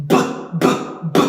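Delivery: "B" as a short voiced "buh"; short "b" bursts, not the letter name; isolated B sound. Shown as a demonstration. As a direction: pop each "b" separately. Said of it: "Let's do the same with B and P".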